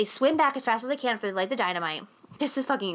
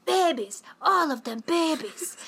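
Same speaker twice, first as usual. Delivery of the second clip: higher, slower, doting mock coo; lightly voiced old man cooing over pets; imitating Jon Voight; 6 semitones higher, slower than usual. Jon Voight cooing over the baby snakes, mock doting. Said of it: "and he's like".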